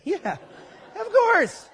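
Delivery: amused laughing agreement. Real, not acted. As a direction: break into a laugh on "of course". Laughs at congregation's mock denial.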